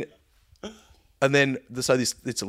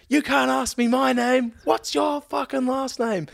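high, slow mock screech; very high-pitched irate passenger; retold yelling; slower than usual. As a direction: imitate her indignant yell, stretching the words. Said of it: "yelling at this hostie".

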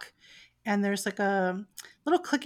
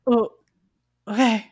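dim dog; 2 semitones higher, 11 dB louder than usual. Acted. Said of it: "the big dog is not the brightest dog".

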